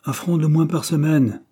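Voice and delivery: storyteller voicing a grumbling worker; gruff. A narrator's reading. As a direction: protest the news with some force. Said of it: "répéta Peters d'un air bourreux".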